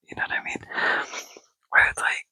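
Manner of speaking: ordinary delivery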